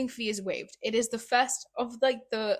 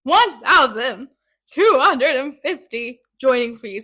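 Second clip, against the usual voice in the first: emphatic; 2 semitones higher, 11 dB louder than usual. Real, not acted. Listing escalating fees.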